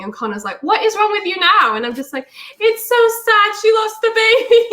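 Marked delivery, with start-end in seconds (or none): 0.6-1.8 high-pitched retold exasperation; exasperated. Partner exasperated at renewed wailing. 2.6-4.6 high, loud mock wail. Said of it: "I just start wailing".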